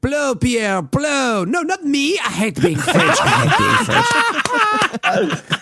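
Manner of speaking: high, hammed-up French accent. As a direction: wail it as a panicking mock Frenchman, pitched high. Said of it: "it's a great French accent".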